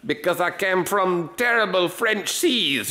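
high, slow bad French accent. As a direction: play a hammy French lobster. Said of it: "French accent for some reason".

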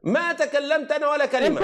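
real indignant outburst; indignant shout. Real, not acted. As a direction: shout down the interruption. Heated crosstalk, raised voice.

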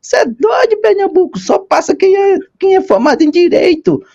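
a friend's scoffing quote, mimicked high and mocking; imitating the friend. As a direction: parrot the doubting friend in a high squeaky put-on voice. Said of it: "ele falava mais ou menos".

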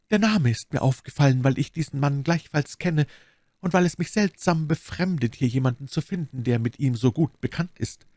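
a hint of a composed lady; lightly voiced Milady explaining herself. Milady covers her shock at the name, lightly voiced. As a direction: explain it away, lightly in character.

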